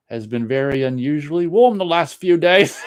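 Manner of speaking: hammed-up Irish lilt on the Dublin weather; Irish accent. Reading a chat message about Dublin in an Irish accent. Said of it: "That's my Irish accent".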